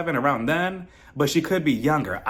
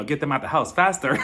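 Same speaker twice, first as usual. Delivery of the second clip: ordinary delivery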